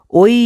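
"ওই" gliding from ও into a long rising ই; model pronunciation. Shown as a demonstration. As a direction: sound out "ওই", stretching the ই. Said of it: "সঠিক উচ্চারণ হলো". ("ওই", ই টেনে স্পষ্ট উচ্চারণে)

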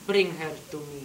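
put-on low flat Hungarian-accented order; stern old grandma's command. Grandma ordering the cop, Hungarian accent. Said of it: "very thick accent".

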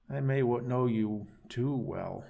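slow, drawn-out doubting tone; skeptical. Acted. Gary doubts his captive's excuse, slow and skeptical. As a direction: let the doubt stretch the words. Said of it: "raising his eyebrows skeptically".

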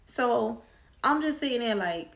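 ordinary talk

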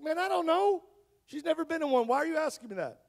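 high, startled, rising disbelief; anxious father, voice jumping up; alarmed confusion. Retold or imitated. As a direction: caught off guard by a scary question. Doctor's question alarms him.

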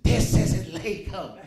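very slow, dazed, laughing protest; slow, laughing paranoid denial; paranoid disbelief. Retold or imitated. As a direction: drag it out in stoned disbelief.

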